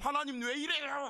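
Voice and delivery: high-pitched acted wail of grievance; mock complaint to God. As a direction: cry out like a struggling believer protesting. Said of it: "하고 원망할 수도 있어요".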